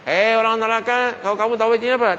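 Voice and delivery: high, loud angel's proclamation; angel calling out. Acted. Angel calling out to the people of paradise, high and loud. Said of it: "Bercakap malaikat".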